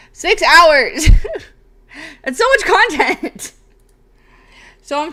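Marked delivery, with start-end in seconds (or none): 0.1-3.5 laughing. Real, not acted. Amazed at six hours' content.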